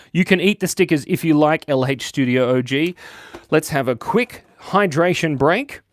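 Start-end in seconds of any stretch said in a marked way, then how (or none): none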